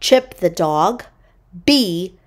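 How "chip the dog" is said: plain reading voice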